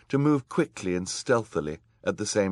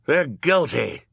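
high, loud villain's verdict; crying out; 8 semitones higher, 6 dB louder, slower than usual. A narrator's reading. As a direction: cry out the accusation. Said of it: "cried the man with the beard".

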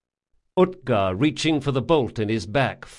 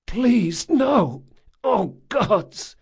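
high, anguished pleading cry; moaning, sobbing; 10 semitones higher, much slower than usual. A narrator's reading. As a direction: beg the gods over her burnt body. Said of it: "Jean moaned, sobbing".

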